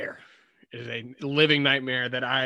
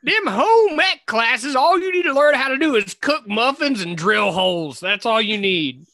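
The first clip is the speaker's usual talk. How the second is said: put-on old fogey voice; 7 semitones higher, 6 dB louder than usual. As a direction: lecture like a crotchety old-timer. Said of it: "we are looking like old fogies".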